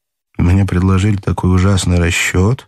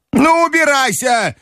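very high, dismissive granny cry; shouting grandmother. A narrator's reading. Grandmother dismissing the smiling clerk, angry cry. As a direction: send him packing in a high voice. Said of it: "крикнула бабушка".

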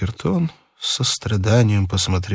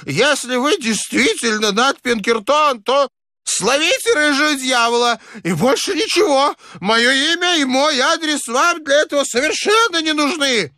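put-on hysterical high-pitched old man; very high, frantic old man's outburst; crying out. A narrator's reading. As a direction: shriek it in panicked suspicion. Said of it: "Старик так и закричал".

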